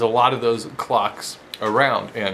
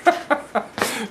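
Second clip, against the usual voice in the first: high burst of laughter; 10 semitones higher than usual. Real, not acted. Laughs at his own joke.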